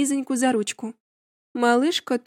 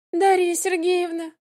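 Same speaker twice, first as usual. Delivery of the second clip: high plaintive drawn-out call; plaintive drawl; 7 semitones higher than usual. A narrator's reading. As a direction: whine the name pitifully. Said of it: "ещё жалобнее протянула она". (жалобно, протяжно)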